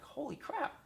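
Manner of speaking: a mild mock gasp of amazement; retold amazement.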